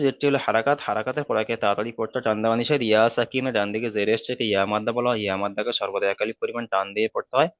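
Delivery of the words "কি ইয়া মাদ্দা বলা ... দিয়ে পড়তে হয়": ordinary delivery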